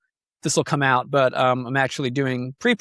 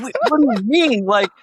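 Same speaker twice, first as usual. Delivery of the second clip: higher, half-joking wounded pitch; mock indignant disbelief; 6 semitones higher than usual. Host retelling his hurt reaction to his partner, mock indignant and higher. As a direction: replay your hurt surprise, hammed up a bit.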